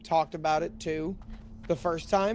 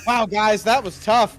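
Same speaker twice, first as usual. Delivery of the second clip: mock streamer voice; 4 semitones higher, 6 dB louder, slower than usual. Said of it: "I caught myself doing that".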